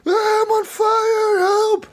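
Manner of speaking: very high, drawn-out mock scream for help. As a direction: imitate a burning game soldier shrieking in panic. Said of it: "like, screaming".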